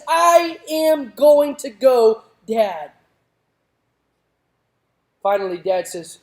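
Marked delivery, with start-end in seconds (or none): 0.1-2.9 stubborn daughter. Retold or imitated. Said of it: "And she says".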